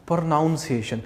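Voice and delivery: "प्रोनाउंसिएशन" with NOUN in the middle; wrong "ow" vowel in "प्रोनाउंसिएशन"; students' mispronunciation. Shown as a demonstration. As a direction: say pro-NOUN-si-ation for "प्रोनाउंसिएशन". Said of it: "इसको कुछ बच्चे बोलते हैं".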